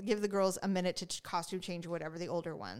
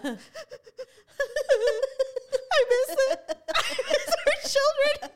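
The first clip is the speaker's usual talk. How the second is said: high, louder laughing sob; half sob, half laugh; crying and laughing; 6 dB louder than usual. Retold or imitated. Remembering the recital, sobbing and laughing at once. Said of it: "I was crying and laughing".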